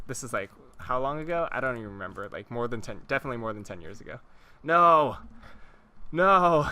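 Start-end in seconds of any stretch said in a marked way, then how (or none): 4.6-6.7 loud, higher-pitched cry of dismay; frustrated shout. Real, not acted. Gamer reacting to a Minecraft mishap, frustrated shout. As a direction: shout it out in exasperation.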